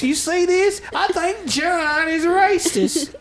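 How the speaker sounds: high-pitched mock scandalized quote; hammed-up, drawn-out accusation; mock gossip. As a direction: draw it out high, imitating a tattler. Acting out outraged Facebook readers.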